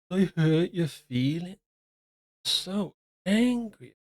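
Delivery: slow, drawn-out taunting impression; mocking whine. Mocking how a pundit brushes off critics, slow taunting whine. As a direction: stretch it out like a smug mock.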